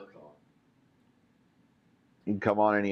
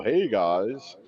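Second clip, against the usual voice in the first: casual greeting impression. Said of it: "He's not gonna show up and go".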